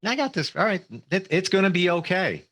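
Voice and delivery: self-assured pep talk. Acted. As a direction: cheer yourself on in a lifted pitch. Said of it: "that's my, my mentality".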